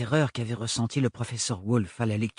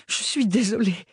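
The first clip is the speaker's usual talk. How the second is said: anxious blurted apology; 8 semitones higher than usual. Acted. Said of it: "dit impulsivement Ophélie".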